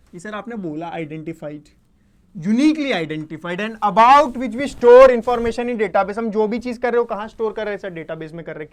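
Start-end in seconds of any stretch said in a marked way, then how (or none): 2.3-6.2 very loud and slow, stretching "about" and "store"; slow, forceful recital with a long loud "store"; emphatic definition reading. Real, not acted. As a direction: pound out the definition for the class, hitting "uniquely", "about", "store" loudly. Rereads key definition emphatically.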